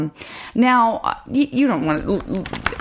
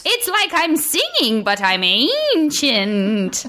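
high, slow sing-song granny voice; very old woman; 8 semitones higher, much slower than usual. Pretend. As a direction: ancient lady drawing out her words. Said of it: "very old lady, grandma cat in me".

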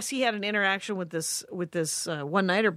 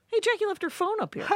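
teasing call from the stage, pitched way up; high mock announcing voice; 12 semitones higher than usual. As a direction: sing it out high to the crowd.

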